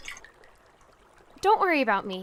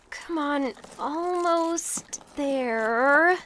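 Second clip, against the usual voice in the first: ordinary talk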